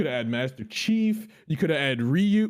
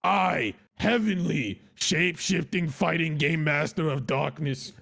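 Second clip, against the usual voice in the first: epic fantasy narrator; slower than usual. Acted. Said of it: "say it like a Coos intro".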